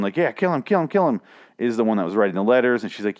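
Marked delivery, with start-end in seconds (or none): 0.2-1.2 higher, egging on the stabbing; a lightly voiced guy cheering for a kill. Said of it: "who was cheering him on".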